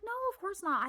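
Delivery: high-pitched eager promise; eager reassurance. Retold or imitated. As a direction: overdo the reassurance. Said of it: "I'm like".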